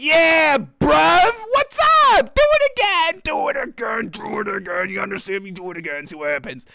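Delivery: taunting a posterized player; mock trash-talk taunt. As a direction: hype up the taunt, then let it drop. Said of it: "staring him down like".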